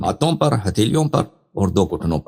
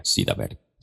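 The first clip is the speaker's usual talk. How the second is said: much lower and softer, an echoed aside; dropped low repeat; 12 semitones lower, 6 dB quieter than usual. Shown as a demonstration. Teacher repeats the point to students, low and soft. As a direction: let the words sink low and soft. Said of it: "سیدھا بیٹھ گئے repeated".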